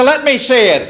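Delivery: a hint of a concerned mom; voiced mother. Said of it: "She said".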